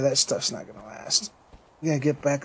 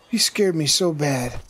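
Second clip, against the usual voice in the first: normal talking voice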